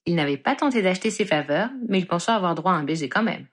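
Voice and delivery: ordinary delivery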